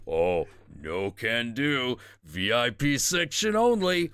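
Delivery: slow, singsong deity turning someone away; golden Buddha. Acted. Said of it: "very triangular golden Buddha".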